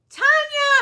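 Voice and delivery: bright high call to a viewer; excited greeting. Real, not acted. Vlogger greeting a viewer by name, excited. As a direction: call out her name happily.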